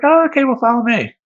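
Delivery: high, loud chirpy clerk; squeaky cheerful follow-me. Retold or imitated. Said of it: "She's like".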